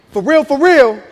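loud, high, emphatic warning; wife meaning it. Retold or imitated. Wife demanding the baby from husband, loud mock-stern warning. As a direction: hit both REALs hard and high, as "for real, for real". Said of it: "So she like".

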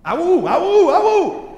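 mimicking a toddler's vowel-only jabber. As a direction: babble like a two-year-old, climbing. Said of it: "I want juice" comes out as".

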